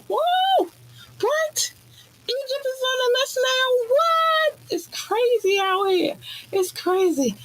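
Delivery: excited shrieking disbelief; real excitement. Real, not acted. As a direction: shriek it like the news just blew your mind. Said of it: "I gotta calm down".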